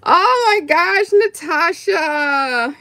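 high, drawn-out grateful wail; touched, near tears. Real, not acted. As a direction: draw the name out, overwhelmed. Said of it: "I'm gonna cry".

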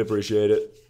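ordinary talk

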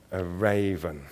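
plain reading voice

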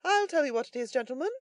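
high character voice; philosophical third man. A narrator's reading. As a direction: settle the dispute for the gentlemen. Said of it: "The third man brought the dispute to a close, most philosophically".

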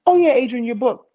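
high, loud eager yes; bright staff voice gushing he's booked; eager reply. Retold or imitated. Production staff answering him.

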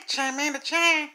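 usual speaking voice

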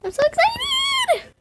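very high squeal; squealing excitement. Pretend. Gamer squealing before the pet hatches, very high squeal. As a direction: squeal it like a thrilled little girl.

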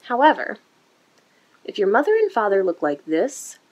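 usual speaking voice

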